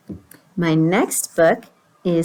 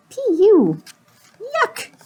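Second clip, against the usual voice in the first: high little fly voices; disgusted flies; 11 semitones higher, slower than usual. Acted. Flies flee the smelly pigeon, high-pitched disgust. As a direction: squeal in disgust at the stink. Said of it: "And the flies are saying".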